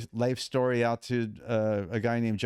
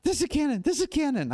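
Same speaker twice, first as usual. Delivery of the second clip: imitating a frantic caller, very high pitch; high-pitched urgent mock phone announcement; hyperventilating caller. Namer calls back two days later, imitated as frantic and hyperventilating. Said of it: "my phone rings with her hyperventilating".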